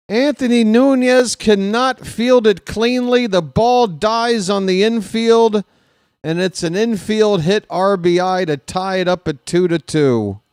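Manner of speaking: high, loud, agitated call; upset commentator, high and easing off slightly; distraught play-by-play. Real, not acted. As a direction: report the blown play in anguish. Recaps the tying hit, upset.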